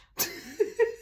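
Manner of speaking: high-pitched real giggle; amused, helpless little laugh. Real, not acted. Laughs at own remark.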